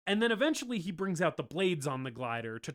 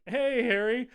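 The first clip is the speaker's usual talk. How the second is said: mildly awkward hey, pitched up; higher, sheepish mock hello; awkward greeting; 7 semitones higher, 4 dB louder than usual. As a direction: greet him like you've just been caught. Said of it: "little awkward".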